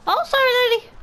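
very high, loud mock apology; squeaky apology. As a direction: squeal a quick sorry. Apologises after bumping into game passer-by.

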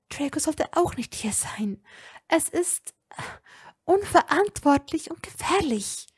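strained, forced through tension; tense, pressed delivery. A narrator's reading. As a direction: squeeze the words out under strain. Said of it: "presste sie schwer hervor".

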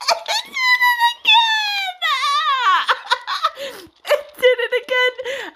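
words squeezed out between laughs; laughing. Real, not acted. Streamer laughing as the joke repeats.

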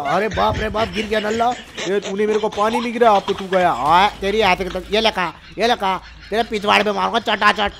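ordinary talk

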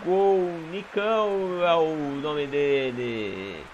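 loud live goal call; football commentator. Acted. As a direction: shout the goal like a broadcaster.

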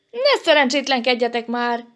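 exasperated scolding; fed up. A narrator's reading. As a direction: lose patience with the others. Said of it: "eligelte meg Elemér".

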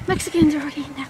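quick, breathy whisper; hushed aside. Real, not acted. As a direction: whisper it fast, under your breath. Quiet aside about occupied porta potties.

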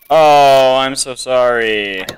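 loud drawn-out oh, then slow sorry; oversized pretend sympathy; mock apology. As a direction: draw out a playful, exaggerated apology. Mock apology to a game entrant.

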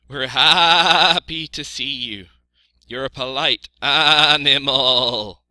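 slow, loud, bleating sheep voice; happy sheep. A narrator's reading. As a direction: stretch the vowels into high bleats.

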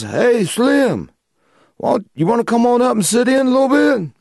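high-pitched imitation of a friend calling over; Larry calling out. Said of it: "Larry just goes".

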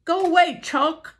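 high, loud shout; angry cry. Acted. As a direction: shout the dog off his spot. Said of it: "he cried".

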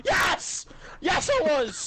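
loud high yell; shouting. Real, not acted. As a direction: shout it back indignantly. Indignant shouting reply.

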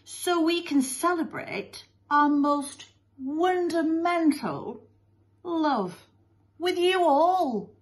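high, slow, gushing mother voice; Missus Lovey-Dovey. Acted. Mother shares their love with school, gushing. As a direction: stretch it out sentimentally.

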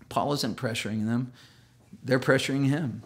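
ordinary talk

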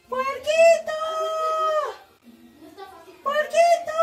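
calling Puerquito across the room. Acted. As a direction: call the name loudly and high. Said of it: "Ayúdenme".

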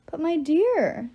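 slow, drawn-out mock endearment; condescending villain, taking his time; rumbling giant. A narrator's reading. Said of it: "the giant rumbled".

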